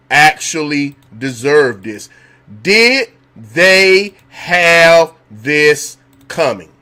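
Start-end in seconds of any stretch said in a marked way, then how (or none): none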